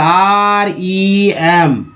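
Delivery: letters spelled out very slowly; slow letter-by-letter spelling for learners. Shown as a demonstration. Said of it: "एक word meaning ले लेते हैं remember का".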